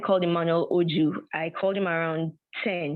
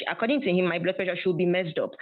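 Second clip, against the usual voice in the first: ordinary talk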